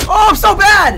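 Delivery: high, loud mock lament; loud wail. Real, not acted. Self-mocking after missed shots.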